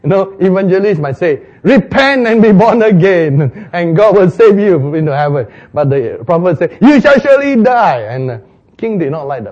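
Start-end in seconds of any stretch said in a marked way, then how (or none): 1.6-5.5 evangelist preaching. Acted. 6.8-8.0 high, loud doom declaration; booming prophecy. Acted. Prophet's doom message acted.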